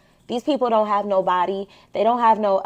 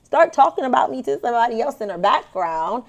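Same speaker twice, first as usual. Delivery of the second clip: ordinary delivery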